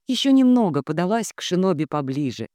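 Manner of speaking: ordinary delivery, nothing marked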